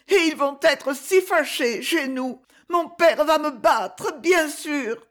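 put-on high, slow child's voice, near tears; a frightened little boy crying it out; sobbing boy. A narrator's reading. Boy fearing his father, tearful high voice. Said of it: "Et les sanglots recommencèrent plus forts".

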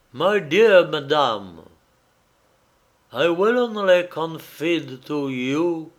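put-on slow, weighty French-accented English; ponderous French accent in English; heavy French accent. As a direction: declaim English loudly with a thick French accent. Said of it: "vous avez un accent français d'une pesanteur insupportable".

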